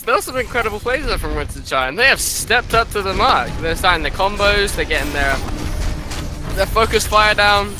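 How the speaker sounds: ordinary delivery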